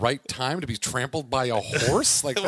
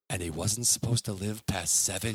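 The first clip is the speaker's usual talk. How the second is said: low, solemn TV narrator; grave sports-feature voiceover, pitched down; announcer voiceover; 8 semitones lower, 4 dB quieter than usual. Retold or imitated. Imitating the Olympic-style tearjerker story, low solemn announcer. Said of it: "the announcer, the voiceover goes".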